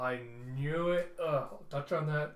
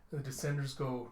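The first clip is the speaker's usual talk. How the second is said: normal talking voice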